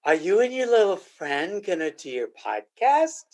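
a hint of a condescending wife, a touch far from his own voice. Husband imitating his wife mocking his podcast, lightly condescending. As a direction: mimic her talking down to you, lightly. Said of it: "this is how she says it".

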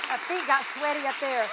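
ordinary talk

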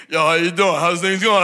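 put-on Rocky Philly accent. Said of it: "started talking like that".

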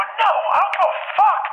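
very high, slow acted scream; drawn-out, high-pitched retold rage; furious outburst. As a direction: screech it slowly in mock fury. Screamed quarrel with the family.

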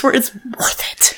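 whispered aside; breathy unvoiced whisper. Real, not acted. Whispered aside after cursing.

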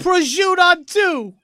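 high loud Jersey Italian call; Jersey Italian accent. Pretend. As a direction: shout it like a Jersey guy. Mock Jersey team huddle call.

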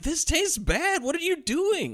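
high, drawn-out complaint; exaggerated high-pitched dismay; mock outrage. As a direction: protest like an appalled kid. Kid protesting cheap generic cola.

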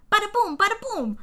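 high, loud mock fighter chant; little boxing clown. Host acting out the clown's captioned noises, high loud chant. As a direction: punch out a cartoonish high chant. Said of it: "my fucking closed captioning".

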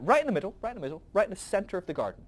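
playful God answering the angels; eager announcement. Retold or imitated. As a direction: proudly point to the center. God says where tree goes.

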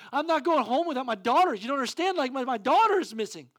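loud, high desperate plea; panicked father. Retold or imitated. Father refusing the prize in panic, loud. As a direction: insist in panic, daughter is missing.

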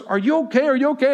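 imitated worried wife, high and loud; alarmed concern. Wife's alarmed question, acted out.

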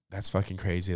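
ordinary delivery

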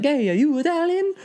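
very high cartoon Italian accent; squeaky-high mock Italian accent; Mario accent. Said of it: "classic Mario accent".

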